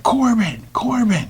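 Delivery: high-pitched imitated mom calling; mother calling out. Mother searching for her hidden son, high calling voice. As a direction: call the name high, searching for him.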